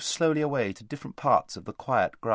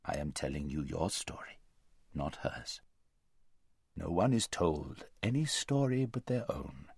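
low, quiet, grave lion; gentle rebuke; 5 semitones lower, 6 dB quieter than usual. A narrator's reading. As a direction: correct her softly and solemnly.

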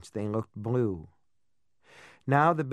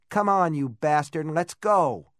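louder, higher insistent urging; urging call; 5 semitones higher, 5 dB louder than usual. A narrator's reading. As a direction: push him to come along. Jo urging him.

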